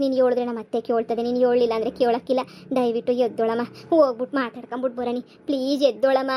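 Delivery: ordinary talk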